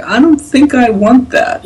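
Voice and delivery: high-pitched, reluctant reply; wary refusal. Retold or imitated. Customer turning down the approved version, wary. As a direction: back away from the offer in a high voice.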